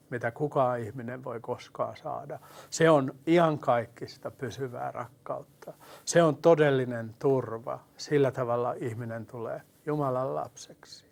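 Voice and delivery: normal talking voice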